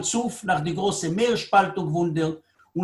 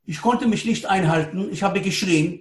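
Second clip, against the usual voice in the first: usual speaking voice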